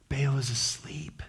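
a hushed whisper as if not to wake him; soft conspiratorial whisper. Pretend. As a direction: whisper it like a secret. Mock secret aside.

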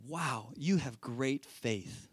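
slow, low, hushed awe; quiet marvelling. Acted. Jesus praising someone's great faith, quietly amazed. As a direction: let the admiration sink in softly and slowly.

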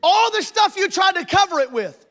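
loud, high, slowed exhortation; full-force preaching. Real, not acted. Preacher pressing the congregation to face their problem, loud. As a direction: drive the point home from the pulpit.

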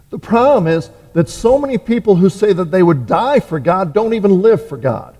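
ordinary delivery

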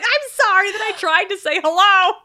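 high, loud mock apology; hammed-up frightened apology; apologizing to ghost. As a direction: plead sorry in comic panic. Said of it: "He apologizes to the ghost".

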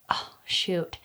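unvoiced muttered oh shoot; hushed self-reproach; whispered aside. Real, not acted. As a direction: whisper it to yourself as you forget. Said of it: "forgets author's name".

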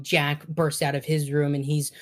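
usual speaking voice

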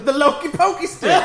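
high, loud laughing announcement; laughing exclamation. Real, not acted. Friend names the scepter, laughing exclamation. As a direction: blurt it out cracking up.

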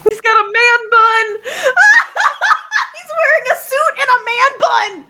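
high squealing laughing shout; giddy high-pitched shout of glee; excited shriek. Real, not acted. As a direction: squeal with delight through laughter. Player thrilled by man bun.

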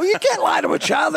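high, appalled protest; shocked objection. Retold or imitated. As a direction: protest in mock outrage. Writers object, voiced high.

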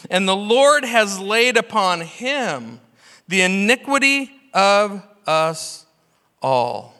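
slow, lifted solemn scripture read. Acted. Pastor reading Isaiah to the church, slow and solemn. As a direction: read it out slowly like holy words.

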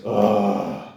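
low groan then a long sigh; mock weary groan and sigh. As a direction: groan like someone sick of the job.

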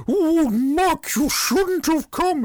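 high, loud, slow fake Scottish woman; mock bad Scottish accent. Podcaster parodying the actress pleading with Mark, mock Scottish accent. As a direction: ham up a terrible Scottish accent. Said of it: "the worst Scottish accent I've ever heard".

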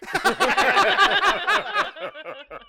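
high loud burst of laughter; big laugh. Real, not acted. Guest laughs hard at joke.

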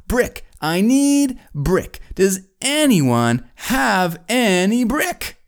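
high, slow, drawn-out plea; exasperated builder. Acted. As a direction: beg everyone word by word, hammed-up frustration. Builder's frustrated plea for brick.

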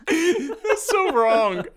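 high, loud, laughing through the words; real laughter at a silly quote. Real, not acted. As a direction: crack up while you protest. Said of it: "laughing reaction".

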